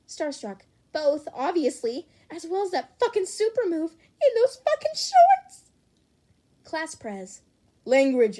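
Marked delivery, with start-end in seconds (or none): 0.9-5.7 much higher and louder, building to a shriek; wild gushing. Acted. Starstruck gushes wildly. 7.8-8.4 a sharp, loud rebuke; stern scolding. Acted. Class Prez scolds swearing.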